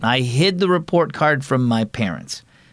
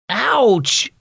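high, sudden ouch; sharp exclamation; 12 semitones higher than usual. Acted. Boy jumping off the swing, yelping ouch up high. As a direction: yelp it out as the splinter goes in. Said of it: "exclaimed Carter".

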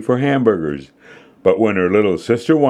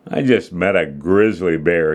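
ordinary delivery

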